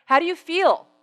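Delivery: high, loud, pointed asking; pointed question. Retold or imitated. Speaker cornering a man after his big meal, loud pointed question. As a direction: put it loudly to the diner.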